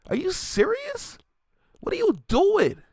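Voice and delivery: can't believe what he's hearing; incredulous. Real, not acted. Incredulous at rapper's leaked snippet.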